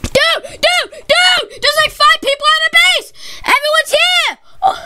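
high, urgent alarm call; alarmed shouting. Real, not acted. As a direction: sound the alarm to the team. Five enemies in the base.

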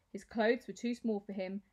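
plain reading voice

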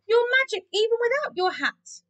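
high, admiring praise; delighted cry. A narrator's reading. Cats praising Keith, delighted. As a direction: sing his praises excitedly. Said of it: "cried the cats".

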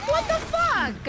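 high, slow shocked cry. Real, not acted. Player attacked, crying out high and slow. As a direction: stretch out the disbelief.

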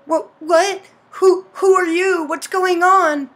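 high, loud stammered questions; panicked heroine. Acted. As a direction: act out demanding answers in fright. Heroine confronts mysterious stranger.

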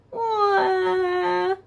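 high pretend baby wail; mock crying wah. Teacher voicing the weeping sheep, playful mock cry. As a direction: fake a little cry for the sheep.

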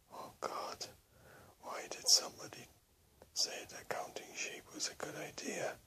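high, crying, frantic whisper; desperate whimpering whisper. Acted. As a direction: whimper it, close to tears. Sleepless, scared of the sheep.

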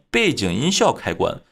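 usual speaking voice

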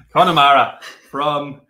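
very loud put-on Irish accent. Shown as a demonstration. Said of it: "I'll do an Irish accent".

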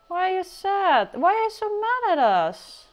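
squeaky voice for the little Sim; high cutesy voice. Pretend.

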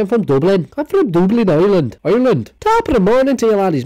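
high, loud hammed-up Irish brogue; mock Irish accent. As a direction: play a cheesy stage Dubliner. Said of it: "My Irish accent's fantastic".

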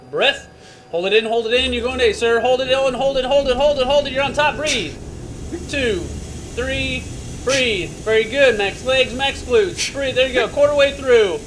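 loud, high urgent commands; shouting coach. Real, not acted. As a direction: drive him hard over the noise. Coach shouting during g-force run.

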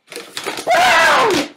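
slow, loud dramatic shout; big boom. Acted. Storyteller shouts the climax to the children. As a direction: burst out the boom slowly.

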